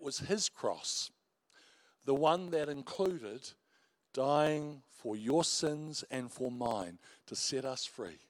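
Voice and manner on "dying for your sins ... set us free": ordinary talk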